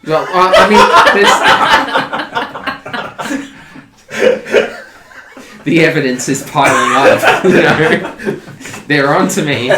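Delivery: loud and laughing. Real, not acted. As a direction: laugh through it, caught out. Laughs while answering.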